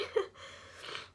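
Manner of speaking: soft breathy laugh through tears; quiet, embarrassed teary laugh. Real, not acted. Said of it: "I'm sorry".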